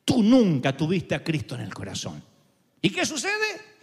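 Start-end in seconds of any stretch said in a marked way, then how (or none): 0.0-2.2 much lower, a cold accusing whisper-close voice; voz acusadora. Retold or imitated. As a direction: speak as the accuser at the mirror, low and sure. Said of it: "viene alguien y se te para al lado". (voz grave y acusadora, en tono de enemigo)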